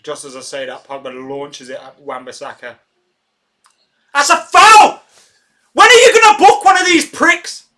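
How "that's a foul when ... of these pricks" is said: much higher and louder, a full-throated yell; furious shout at the ref. Real, not acted. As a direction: scream your outrage at the referee. Fan yelling at the referee.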